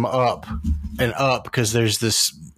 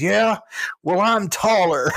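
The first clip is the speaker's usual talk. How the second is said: high-pitched comeback, stretched at the end; imitated yell; 9 semitones higher, slower than usual. Hero's zinger after the villain dies, mock yell. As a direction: land the zinger as a mock action-hero yell. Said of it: "Jack just yells".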